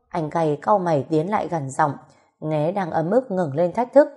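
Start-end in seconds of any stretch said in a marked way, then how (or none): none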